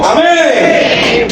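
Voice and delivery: high, ringing amen; shouted amen. Real, not acted. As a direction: call it out to the congregation. Preacher's closing shout. (amén gritado y agudo)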